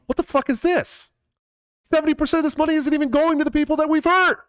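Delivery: high, outraged voice of an honest man; incredulous outburst. Retold or imitated. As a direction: act out shocked disbelief. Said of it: "would have looked at that bill and gone".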